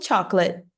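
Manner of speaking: chocolate as CHAWK-luh, final T unreleased. Shown as a demonstration. Teacher shows own accent to learners, chocolate with no audible T. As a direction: drop the T at the end of English chocolate. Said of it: "nem o T você pronuncia".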